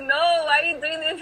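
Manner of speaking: upset. Retold or imitated. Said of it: "I got so mad at her".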